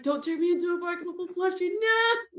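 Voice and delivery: high fast shout of protest; mock indignant shout. Player mocks Nextra refusing the plushie, high shout. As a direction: yell it like a cheated mascot. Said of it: "she's like".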